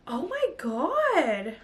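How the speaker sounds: slow, hushed oh my God; awed. Real, not acted. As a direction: breathe it out in disbelief. Sees the box photos.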